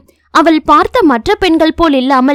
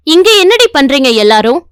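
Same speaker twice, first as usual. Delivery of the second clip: usual speaking voice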